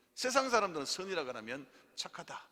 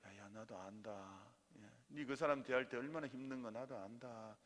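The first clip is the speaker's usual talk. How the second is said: God's comforting voice; 4 semitones lower, 7 dB quieter than usual. Retold or imitated. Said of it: "하나님께서".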